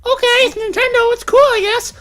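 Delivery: put-on very high kid voice; squeaky put-on little boy; child accepting reluctantly. Child settling for Dad's old console, high-pitched. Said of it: "when we have kids".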